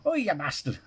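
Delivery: lightly imitating the clip's northern English accent; a hint of northern English accent; mock northern English accent. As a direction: echo the northern English "Oi, you bastard" for a laugh. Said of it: "I love that accent".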